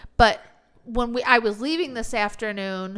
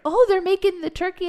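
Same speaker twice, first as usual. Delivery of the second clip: high, mock-amazed coordinator; 10 semitones higher, 4 dB louder than usual. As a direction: imitate the coordinator's wide-eyed surprise.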